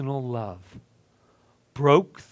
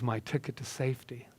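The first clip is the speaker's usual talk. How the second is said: whispering; 8 dB quieter than usual. Acted. Unvoiced whispered line.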